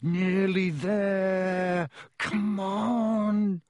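very slow, high coaxing; cajoling. A narrator's reading. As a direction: coax it along with long, strained vowels. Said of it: "Rod said cajolingly".

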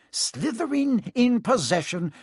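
high, slow commentary call; match commentator. A narrator's reading. Lee Jordan calling the match, high commentary voice. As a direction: announce the play like a commentator. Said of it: "Lee Jordan was saying".

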